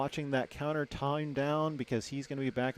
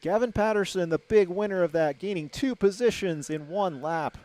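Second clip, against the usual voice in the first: higher, louder thrilled call; excited race call; 6 semitones higher, 8 dB louder than usual. Real, not acted. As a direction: shout the pass as it unfolds. Three-wide pass, rising excitement.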